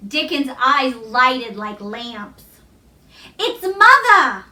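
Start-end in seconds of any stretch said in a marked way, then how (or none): 3.4-4.4 excited cry. A narrator's reading. Dickon running to greet his mother, crying out happily. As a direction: cry out with delight at seeing her. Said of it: "he cried".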